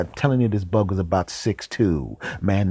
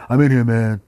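raised call through a door; lightly acted, louder and higher; 4 semitones higher, 7 dB louder than usual. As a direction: call out to someone barging in. Voice calls from behind bathroom door.

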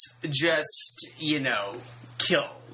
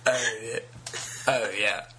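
Pierce Brosnan sex sounds; 7 semitones lower, 4 dB quieter than usual. Pretend. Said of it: "So then I'll do a Pierce Brosnan".

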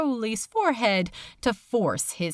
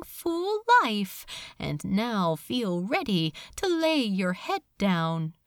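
plain reading voice

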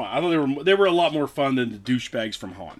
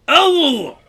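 surprised yelp; 14 semitones higher, 10 dB louder than usual. Real, not acted. Reacts to gross drink.

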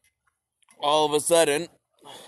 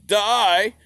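higher loud barked die; mock yell; 7 semitones higher, 7 dB louder than usual. Monarchy answering the rebels, loud. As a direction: snap a death order. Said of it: "the monarchy was like".

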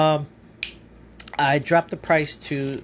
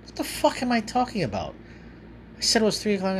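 sudden higher exasperated question; catching his own mistake; surprised; 8 semitones higher, faster than usual. Real, not acted. Realises he has the wrong day.

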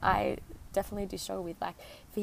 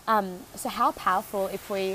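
raised voice against the wind; shouting over wind; 7 dB louder than usual. Real, not acted. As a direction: project loudly over gusts. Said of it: "hopefully you can hear me over this wind".

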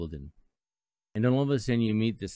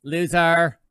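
loud, higher taunt of one word; taunting shout; 7 semitones higher, 11 dB louder than usual. Retold or imitated. Texter taunting host, loud mocking call. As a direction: spit out the insult. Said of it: "they text me and said".

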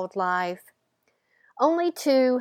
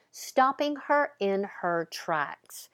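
ordinary delivery

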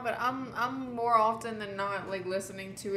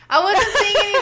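giggling through the words, louder and higher; real laughing confession; 9 semitones higher, 14 dB louder than usual. Real, not acted. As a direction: laugh it out, embarrassed at yourself. Laughing at her own panic.

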